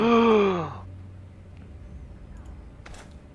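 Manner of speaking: sharp high gasp of fright; startled gasp. Real, not acted. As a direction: gasp in shock. Sudden scare in horror game.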